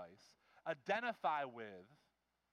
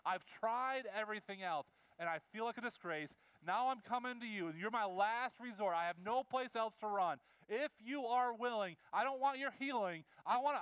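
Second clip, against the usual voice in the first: urgent acted pleading, pitched up; desperate plea; 4 semitones higher than usual. Leper begging Jesus to bring him in, desperate. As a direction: plead as someone at the last resort. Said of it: "he's so desperate".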